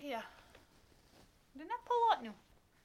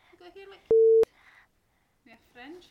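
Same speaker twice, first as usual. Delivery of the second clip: ordinary talk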